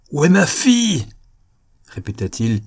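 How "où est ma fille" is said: loud, high furious demand; enraged father's outcry; drunk with fury. A narrator's reading. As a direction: shout the question in a rage, falling at the end. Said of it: "ivre de fureur".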